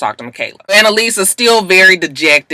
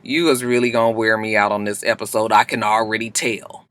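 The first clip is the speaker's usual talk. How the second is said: low sassy aside; 7 semitones lower, 7 dB quieter than usual. Retold or imitated. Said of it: "I was just looking at Laurel like".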